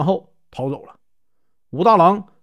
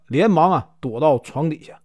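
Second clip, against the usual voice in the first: plain reading voice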